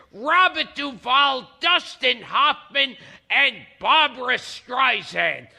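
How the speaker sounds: emphatic, punched show-host read; talk-show intro, each credit hit hard; announcer introduction. Acted. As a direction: announce the guest with big stressed names. Host reads the guest introduction.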